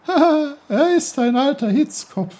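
high giggling stream voice. Acted. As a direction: giggle at the hothead. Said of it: "Kicherte der Bach".

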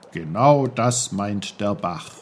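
lightly voiced patient old uncle; low, patient old uncle. Uncle explains.

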